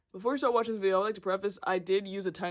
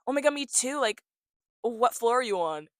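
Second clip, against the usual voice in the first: mock excited gymnast; 5 semitones higher, 4 dB louder than usual. Voicing other gymnasts excitedly.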